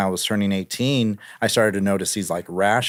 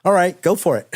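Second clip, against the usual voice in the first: dismissive airboat guy; 8 semitones higher than usual. Retold or imitated. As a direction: toss it off like you couldn't care less. Retold quarrel with airboat guys.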